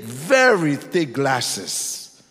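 "very" stretched out long and loud; drawn-out, emphatic "very" before "thick glasses"; stress on very. Real, not acted. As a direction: lean hard and slowly on "very". Emphasising how thick the glasses were.

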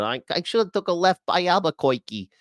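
high cartoon wiseguy voice; Bugs Bunny. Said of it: "Bugs Bunny. He uses, he always said".

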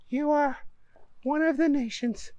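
Donna praising in a high voice; high woman voice. Acted. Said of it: "said Donna".

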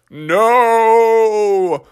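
long, high cry of dismay; stretched-out dramatic wail; Father Bear. Acted. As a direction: draw it out in theatrical horror. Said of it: "Father Bear was like".

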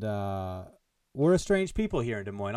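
ordinary talk

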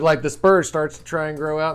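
ordinary delivery

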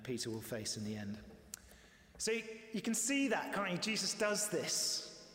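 usual speaking voice

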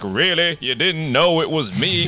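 slow, deadpan "really?"; dry sarcasm. Retold or imitated. Sam mocking the cops, slow and dry. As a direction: let it land dry and unimpressed. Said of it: "And he's like".

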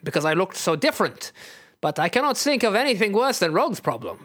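put-on lilting accented character, often high; earnest, swooping accented voice; Nightcrawler. Nightcrawler pities Rogue to Wolverine, in accented voice. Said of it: "you tell Wolverine".

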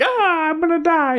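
high panicked mock yelp. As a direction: squeal it like you're terrified. Retold panic at grouse.

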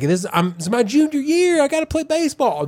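put-on high whiny teenage protest; upset teen son. Son protesting the move to his parents, high whiny voice. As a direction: whine it like a teen robbed of his junior year. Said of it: "the son was upset 'cause he's like".

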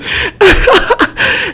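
real high-pitched giggle fit; high laughter. Real, not acted. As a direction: burst of high, loud giggling. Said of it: "I turned up the volume".

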